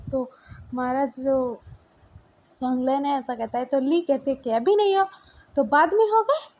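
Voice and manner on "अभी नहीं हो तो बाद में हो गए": much higher and louder, mocking disbelief; sarcastic retort. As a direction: snap the question back at him. (बहुत ऊँची, तेज़ आवाज़ में व्यंग्य भरा सवाल)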